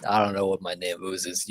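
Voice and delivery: normal talking voice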